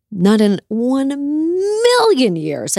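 slow, high, drawn-out refusal; emphatic refusal. Real, not acted. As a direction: stretch each word to shut the idea down. Asked about running for office.

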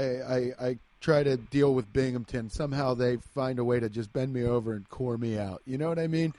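ordinary talk, nothing marked

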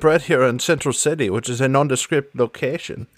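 put-on theatrical accented villainess; Sans Serif, puzzled. Puzzling over her friend's Southern voice, accented villainess. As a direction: question him archly in accent.

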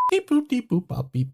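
phone dial tones done by mouth; imitated dialing beeps. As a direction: beep out a number being dialed. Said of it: "Use that sound, David, when he's dialing".

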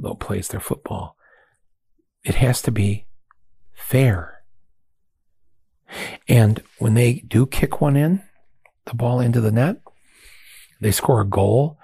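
ordinary delivery

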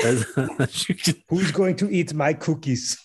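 slow movie villain voice. Shown as a demonstration. Movie quote impression.

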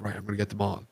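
hushed, confident murmur before the ambush; sneaky quiet. Retold or imitated. Sneaking up on enemies, quietly smug. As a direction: keep it low, like you're creeping up on a team. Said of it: "I was like".

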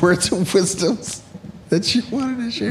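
high, slow, giggly put-on voice; laughing mock voice. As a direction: ask it while cracking up, in a silly voice. Mock question through laughter.

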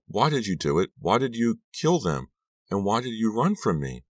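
high-pitched, pleading questions; choked-out plea. A narrator's reading. Girl demands answers from Lucy, high anguished plea. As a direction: press each question with rising anguish. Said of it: "I managed to choke out".

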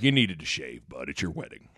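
deep, quiet deadpan coach; Coach Altman. Retold or imitated. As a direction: imitate a coach, low and dead serious. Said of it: "he was dead serious".

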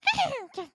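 high little animal moans; tiny wordless squeaky grunts; porcupine eating noises. Retold or imitated. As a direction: imitate a porcupine happily eating. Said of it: "they go like".

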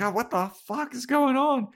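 high-pitched mock yelp of outrage; pained outcry. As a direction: cry out as if suddenly burned. Sunlight burns Spike.

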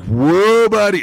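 loud, high alarmed whoa; startled yelp. Retold or imitated. Lifter as spotter heaves the bar, startled yelp. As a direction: re-enact being yanked off balance, loudly. Bar nearly flies from his hands.

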